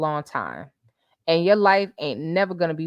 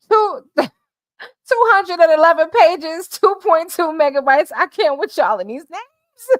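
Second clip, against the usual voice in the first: high, loud, laughing disbelief; cracking up; 10 semitones higher, 7 dB louder than usual. Real, not acted. Reading a commenter's silly name, cracking up. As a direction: squeal it through real laughter, much higher.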